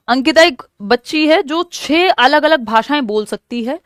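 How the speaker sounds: ordinary talk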